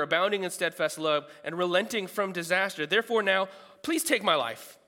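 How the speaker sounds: preacher half-acting Jonah's bitter prayer; reading Jonah's rant. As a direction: give voice to the prophet's complaint, loud. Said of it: "He goes on a rant".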